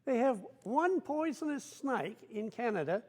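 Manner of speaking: high, amused Australian crew boss; lightly voiced Aussie boss, high and gleeful; crew boss laughing. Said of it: "So he says".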